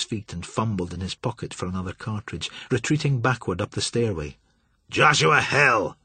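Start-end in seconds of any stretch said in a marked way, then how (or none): 4.9-6.0 slow, loud furious roar. A narrator's reading. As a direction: roar it into the darkness. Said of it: "he roared down at the darkness".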